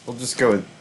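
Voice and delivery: normal talking voice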